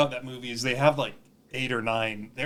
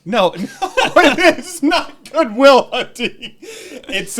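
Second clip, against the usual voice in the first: laughing; 11 semitones higher, 11 dB louder, much slower than usual. Real, not acted.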